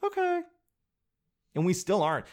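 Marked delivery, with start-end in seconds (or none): 0.0-0.4 tiny, very high squeak; meek Canada. Acted. Canada giving in to England, tiny high meek voice. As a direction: give in with a high little okay. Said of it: "And we're like".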